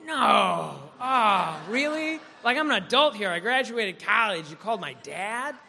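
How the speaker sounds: mock indignation, an affronted grown man; indignant outburst. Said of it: "It's like".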